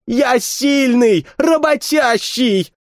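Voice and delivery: high, slow, desperate plea; desperate pleading. Acted. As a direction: beg for your life with everything. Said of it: "вкладывая в голос ... все имеющиеся у него силы".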